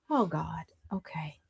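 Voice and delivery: whisper. Real, not acted. Dismayed at video.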